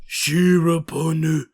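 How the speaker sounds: Shiraponu said slowly with a Japanese final nu; careful Shiraponu with an added u; Japanese-style pronunciation. Retold or imitated. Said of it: "your pronunciation of the Japanese runners".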